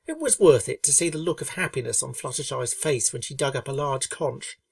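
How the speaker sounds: ordinary delivery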